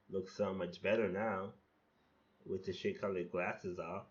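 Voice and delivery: ordinary talk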